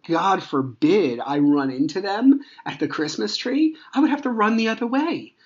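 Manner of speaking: climbing to a high-pitched incredulous peak; exasperated. Real, not acted. Dad under restraining order imagines a run-in, exasperated. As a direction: let the absurdity push the pitch way up.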